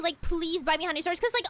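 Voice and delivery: ordinary talk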